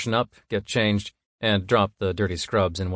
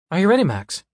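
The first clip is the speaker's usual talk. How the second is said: much higher, louder question; high female voice; 10 semitones higher, 6 dB louder, faster than usual. Acted. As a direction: ask brightly and eagerly. Monica asks, high.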